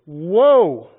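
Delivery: woe cry. Acted. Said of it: "He was".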